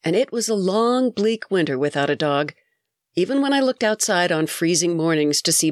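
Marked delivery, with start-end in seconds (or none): none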